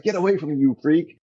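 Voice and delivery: lightly voiced spooked friend; scared friend. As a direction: push him away in alarm. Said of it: "And he's like".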